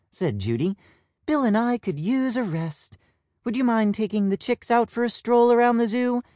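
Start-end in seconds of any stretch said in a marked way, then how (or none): 1.2-6.2 mother peacock. Acted. Judy asks Plum a favour.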